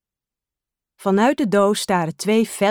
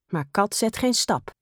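plain reading voice

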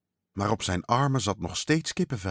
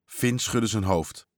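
usual speaking voice

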